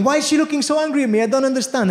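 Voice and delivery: high, hurried mock panic; panicking. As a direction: play a flustered mum who can't understand. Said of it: "My mother's panicking".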